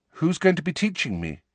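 put-on young Harry, anxious; Harry asking nervously. A narrator's reading. Harry voiced by narrator.

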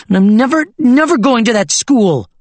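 high, loud, stamping-foot refusal; furious child shout. Acted. Girl refuses school to her grandfather, shouting in a tantrum. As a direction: throw the tantrum on every never.

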